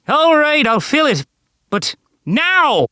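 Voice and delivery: high, pained yelps; yelping in pain. A narrator's reading. The leprechaun yelping in the hammerlock, in pain. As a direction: cry out as the arm is twisted. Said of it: "The old man yelped".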